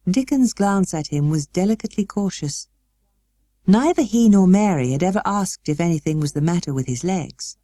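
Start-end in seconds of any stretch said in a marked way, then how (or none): none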